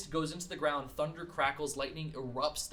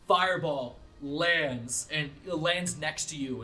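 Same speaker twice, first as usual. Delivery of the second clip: usual speaking voice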